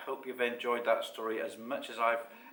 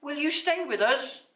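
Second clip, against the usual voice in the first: high, loud little voice; high-pitched hopeful creature; Basil; 7 dB louder than usual. A narrator's reading. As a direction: pop the big question. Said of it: "Basil paused".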